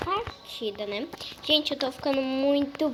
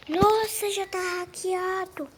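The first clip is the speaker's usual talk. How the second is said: surprised high exclaim; 6 semitones higher than usual. Real, not acted. Sudden surprise in game.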